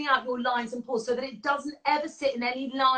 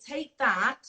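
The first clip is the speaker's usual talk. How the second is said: ordinary talk, nothing marked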